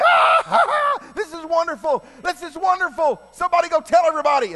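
hammed-up shrieking joy, very high and loud; whooping, excited crowd imitation; overjoyed outburst. Believers see Tabitha alive, overjoyed shouting.

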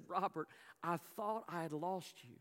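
low, soft retold reunion; tearful relief, understated. Preacher voicing a mother to her found son, hushed relief. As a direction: speak softly and low, as a relieved mother holding her child. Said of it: "tears down her face".

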